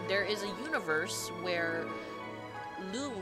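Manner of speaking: ordinary delivery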